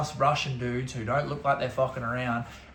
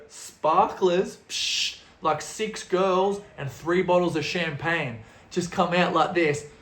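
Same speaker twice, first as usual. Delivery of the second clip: ordinary talk, nothing marked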